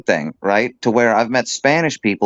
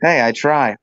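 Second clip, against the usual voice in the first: normal talking voice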